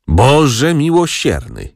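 slow, high-pitched groan of dismay; drawn-out moan to heaven; moaning lament. A narrator's reading. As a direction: wail it slowly, as an exasperated aunt. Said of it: "jęknęła ciotka".

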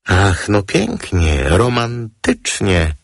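slow, high, swooning exclaim; drawn-out gushing "romantic"; exclaimed mockingly. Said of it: "zawołał anioł". (przeciągnięty, egzaltowany okrzyk)

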